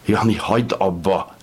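desperate plea; begging. A narrator's reading. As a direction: beg him to stop. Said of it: "könyörgött".

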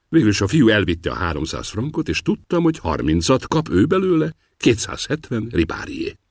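ordinary delivery

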